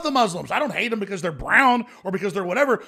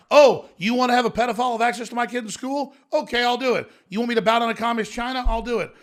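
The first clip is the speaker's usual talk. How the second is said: mock spineless voice; 6 semitones higher, 4 dB louder than usual. Mocking America's spineless attitude.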